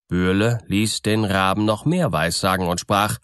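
plain reading voice

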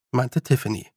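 ordinary delivery, nothing marked

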